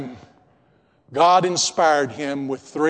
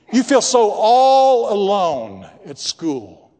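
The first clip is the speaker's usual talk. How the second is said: fervent preaching; 3 semitones higher than usual. Real, not acted. Preacher pleading with the congregation.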